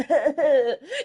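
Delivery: real giggle after a slip; caught-out chuckling; embarrassed laugh. Real, not acted. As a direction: burst into helpless giggles. Laughs after slipping out a name.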